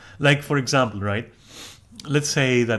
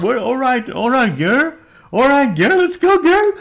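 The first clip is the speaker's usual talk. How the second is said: much higher and louder, climbing, hammed-up sassy cheer; sassy hype girl; 13 semitones higher, 9 dB louder than usual. Mocking someone who suddenly plays the sassy girlfriend, high and loud. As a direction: hype her up like an over-the-top gal pal. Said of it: "you immediately go like".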